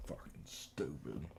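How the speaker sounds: slow whispered swearing; whispered curse. Real, not acted. Frustrated programmer whispering at the screen, slow whispered curse. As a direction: breathe the curse out slowly at the code. Code still not working.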